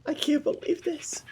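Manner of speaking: breathless whisper of disbelief; barely voicing it, overwhelmed; awed whisper. Whispered disbelief after reunion.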